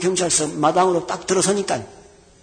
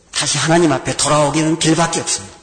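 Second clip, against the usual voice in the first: ordinary talk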